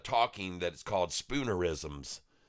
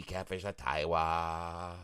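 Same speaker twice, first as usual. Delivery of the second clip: silly character; 3 semitones lower, slower than usual. Acted. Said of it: "who this silly character is".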